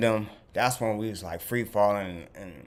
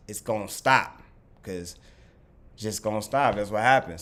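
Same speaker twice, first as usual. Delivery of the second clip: ordinary delivery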